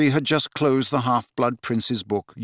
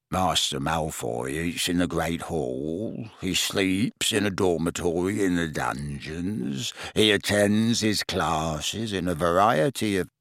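lower, slow, quieter grudging croak; sulky croaking elf; 6 semitones lower, 4 dB quieter, slower than usual. Acted. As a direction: croak it out furious and stalling. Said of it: "looking furious".

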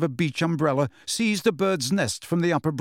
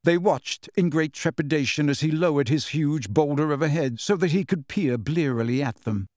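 ordinary delivery

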